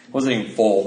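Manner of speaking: ordinary talk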